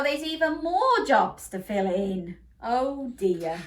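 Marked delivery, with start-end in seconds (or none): none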